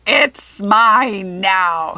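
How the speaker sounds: loud, drawn-out goblin yell. A narrator's reading. Peter Pan goblin running off with the apple, yelling. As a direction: stretch it out, gloating. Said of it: "he yelled".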